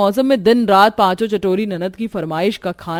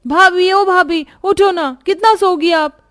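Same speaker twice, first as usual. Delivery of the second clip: high, loud, drawn-out wake-up call; 11 semitones higher, 5 dB louder than usual. Acted. Nanads waking bhabhi, loud drawn-out calling. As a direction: shout her awake, slowly.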